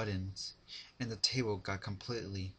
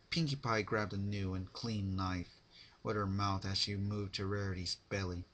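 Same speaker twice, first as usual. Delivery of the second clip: ordinary delivery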